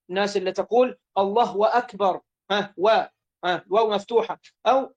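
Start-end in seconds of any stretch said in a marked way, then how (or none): 1.2-2.2 الله أكبر with an extra open waw joining the words; inserted "wa". Shown as a demonstration. Teacher mimics people's mistake, slipping "wa" before أكبر. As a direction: add a "wa" between الله and أكبر, "Allahwa akbar". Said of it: "وألا يزيد واوا ساكنة أو متحركة".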